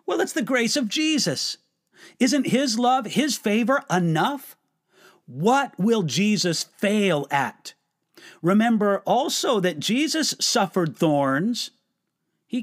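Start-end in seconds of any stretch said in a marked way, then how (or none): none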